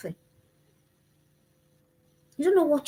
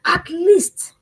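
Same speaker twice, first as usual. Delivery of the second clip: self-pep talk; 5 semitones higher, 6 dB louder than usual. Retold or imitated. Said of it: "And I said".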